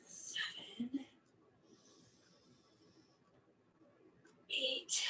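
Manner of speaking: breathless whispered counting. Real, not acted. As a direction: mouth the count under strain. Counting reps under her breath.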